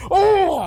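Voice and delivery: sudden high yelp of pain. Acted. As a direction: yelp as the brick hits your face. Brick smashes his own face.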